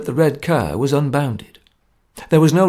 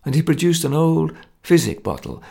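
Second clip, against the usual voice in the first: plain reading voice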